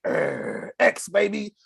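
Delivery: mock [name] growl. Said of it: "I was like".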